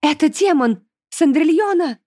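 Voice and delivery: grandmother's high-pitched warning cry; lightly voiced alarmed grandmother; loud exclamation. A narrator's reading. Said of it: "громко воскликнула бабушка".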